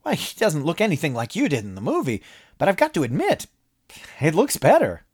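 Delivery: a breezy high-pitched promoter pitching ideas; cheerful businessman Moss. A narrator's reading. Moss excited.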